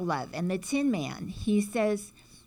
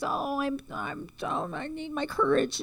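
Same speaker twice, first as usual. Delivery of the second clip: high, whimpering scaredy-cat lion; cowardly lion; 8 semitones higher than usual. Retold or imitated. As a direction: whine it like a frightened lion. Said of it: "So the guy says".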